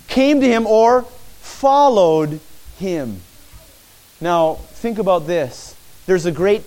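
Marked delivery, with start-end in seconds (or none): 0.1-3.2 "followed" drawn out slowly after a pause; stress on followed. Shown as a demonstration. Preacher pointing listeners to the key word, stress on "followed". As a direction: lean hard and long on "followed". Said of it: "look at the word that we should focus on".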